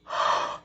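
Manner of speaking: quick gasp in before holding breath; sharp gasp. Real, not acted. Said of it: "holding my breath".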